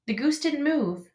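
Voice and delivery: ordinary delivery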